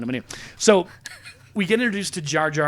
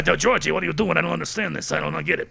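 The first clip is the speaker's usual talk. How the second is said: mock old critic, baffled and complaining; old guy critic; 3 semitones higher than usual. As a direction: grumble like an old man in his chair. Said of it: "old guy in a chair".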